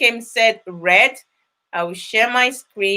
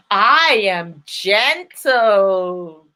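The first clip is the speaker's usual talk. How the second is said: slow affirmation; 6 semitones lower, much slower than usual. Shown as a demonstration. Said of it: "make sure you shout it with so much conviction".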